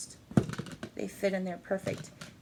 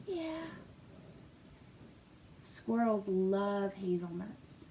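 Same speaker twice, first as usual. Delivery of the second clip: ordinary delivery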